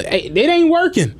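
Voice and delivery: high mocking voice for the riders; playful mock of baffled riders; mock passenger voice.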